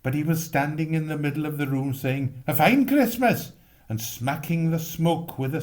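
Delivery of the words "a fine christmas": sarcastic exasperation. Acted. Mr Prothero amid the smoke.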